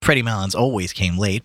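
plain reading voice

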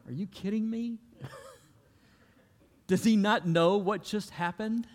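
lightly voiced stunned fisherman; incredulous Peter. Peter reacting to the calmed storm, high disbelief. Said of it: "I can imagine Peter going".

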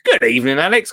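normal talking voice

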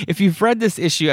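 ordinary talk, nothing marked

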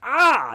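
high, loud "ah" of sudden pain; pained yelp. Retold or imitated. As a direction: yelp as if hurt harder. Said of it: "whatever it was, harder".

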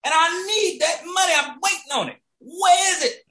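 high-pitched exasperated rant; retold indignant protest. Retold quarrel over missing money.

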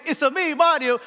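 high sing-song Italian; punchy cartoon Italian catchphrase; Mario's Italian accent. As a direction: belt it out high in mock Italian. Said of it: "when we thought he was saying".

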